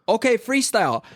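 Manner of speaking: high-pitched imitation of an instructor's cue; dance instructor's call. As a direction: call it out like the choreographer. Choreographer calls out the freestyle.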